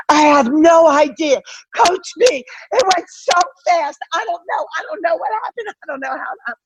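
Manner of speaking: high, breathless panic, fading to a small voice; panicked rush. Retold or imitated. Assistant coach telling head coach the game blurred by, panicked. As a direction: act out a flustered young assistant losing it.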